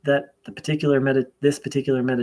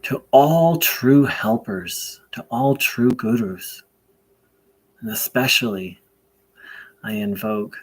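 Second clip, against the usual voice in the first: prayer invocation; 4 dB louder than usual. Real, not acted. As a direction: pray aloud deliberately, leaving long pauses between phrases.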